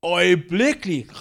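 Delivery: "Øjeblikkelig" drawn out and loud; "Øjeblikkelig" proclaimed with weight; emphatic. Shown as a demonstration. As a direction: hit "Øjeblikkelig" hard, slowly. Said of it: "Kan vi sige øjeblikkelig?".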